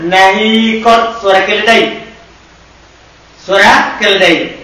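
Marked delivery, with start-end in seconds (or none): 0.0-1.2 slow dictation. Shown as a demonstration. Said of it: "teacher dictating Arabic form".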